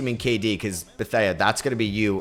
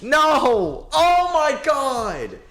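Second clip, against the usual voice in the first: anguished wail; 10 semitones higher, slower than usual. Real, not acted. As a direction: wail like the series is slipping away. Losing two games by one.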